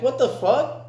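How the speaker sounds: high-pitched retold outrage; indignant, pitch jumping up; outraged disbelief. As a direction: act out shock at being punched. Acted reaction to a punch.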